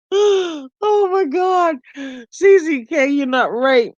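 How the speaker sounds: high, drawn-out gasp after laughing; recovering from a laughing fit; real, high, worn-out-from-laughing voice; laughing to tears. Real, not acted. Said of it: "You're gonna make me cry".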